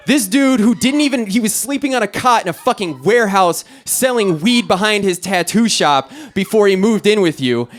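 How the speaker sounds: high-pitched, exasperated tirade; incredulous rant. Acted. Rescuer berating friend about her boyfriend, incredulous rant. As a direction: rant in outrage, voice pushed up high.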